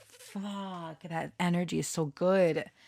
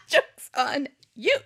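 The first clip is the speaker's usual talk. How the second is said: laughing repeat; 10 semitones higher, 6 dB louder, slower than usual. Real, not acted. Repeats her retort laughing.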